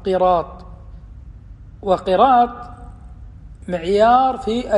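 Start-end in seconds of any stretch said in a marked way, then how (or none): 0.1-0.7 قراط starting with a short "i", qiraat; kasra on the qaf. Shown as a demonstration. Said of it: "بكسر القاف". (قراط بكسر القاف)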